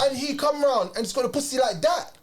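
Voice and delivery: hammed-up heartbroken rant at the cheating girlfriend; indignant rant. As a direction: vent the betrayal at her.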